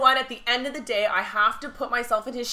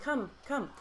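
soft, short coaxing words; hushed beckoning; 2 semitones higher, 11 dB quieter than usual. Retold or imitated. Actor waves fan forward to his table, hushed beckoning. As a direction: coax quietly, one word at a time.

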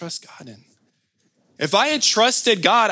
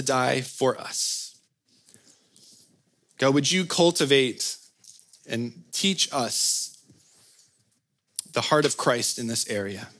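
closing prayer. Acted.

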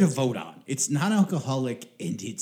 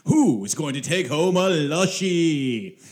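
put-on old radio serial announcer; 3 semitones higher than usual. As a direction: announce it like a 1940s radio show. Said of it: "So I can do my radio announcer voice".

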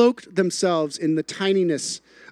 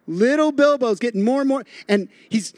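ordinary talk, nothing marked

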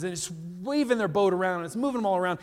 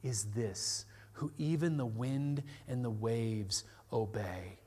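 soft, slow, low wonder; hushed amazement; quiet awestruck question; awed disciples; 8 semitones lower, 11 dB quieter, slower than usual. Retold or imitated.

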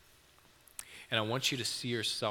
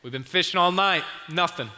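loud, higher call back to shore; shouted reply; 8 semitones higher, 10 dB louder than usual. Acted. Tired fishermen shouting back to Jesus on shore, loud. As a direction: yell it back across the water, fed up.

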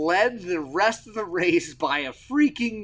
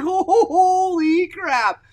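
slow, loud, high, laughing through it; laughing disbelief; 8 dB louder, much slower than usual. Retold or imitated. Dad watching his son pull away, laughing slow disbelief. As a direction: stretch it out, cracking up in amazement. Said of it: "I was like".

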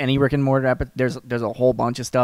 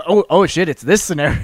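alarmed realization, louder; sudden panicked recognition; excited; 4 semitones higher, 6 dB louder than usual. Retold or imitated. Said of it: "I was like".

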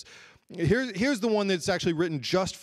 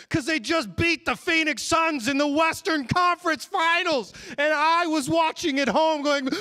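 high, fired-up retelling; worked-up excitement; 8 semitones higher, 3 dB louder than usual. Real, not acted. Preacher reliving the Suns' defeat for the congregation, high and excited. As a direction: get carried away telling the win.

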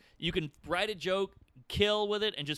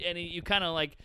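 ordinary talk, nothing marked

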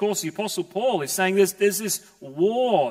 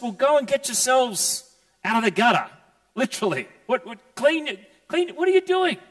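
much higher, mocking scold; scolding priest; 7 semitones higher, faster than usual. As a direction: sneer at them to clean up. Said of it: "Not just".

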